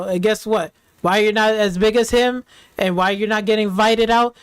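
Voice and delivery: normal talking voice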